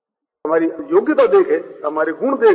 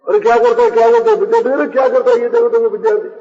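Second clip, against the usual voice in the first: raised-voice mock alarm; loud, higher retold protest; alarmed outcry; 4 semitones higher, 4 dB louder, faster than usual. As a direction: call out urgently, acting the shocked guru. Guru protests child thrown into well.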